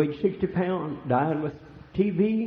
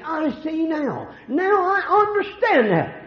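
high exclaimed realization; 11 semitones higher, 5 dB louder, slower than usual. Retold or imitated. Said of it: "After a while we say".

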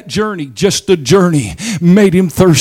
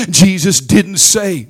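usual speaking voice

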